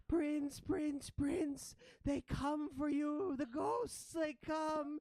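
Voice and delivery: high, loud cry of alarm; screaming ratling. Acted. Ratling warns her prince the ghosts come, screaming alarm. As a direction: shriek a warning at a high pitch. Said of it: "she starts screaming out".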